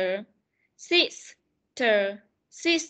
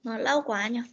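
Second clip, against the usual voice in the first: ordinary talk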